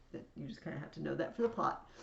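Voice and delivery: usual speaking voice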